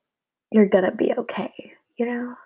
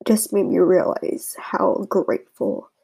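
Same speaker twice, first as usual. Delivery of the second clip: ordinary talk, nothing marked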